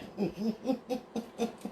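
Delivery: long, soft, high-pitched chuckle; quiet run of high giggly laughs; mocking chuckle. Real, not acted. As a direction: chuckle away in little pulses at the smartass. Laughs after mocking the smartass.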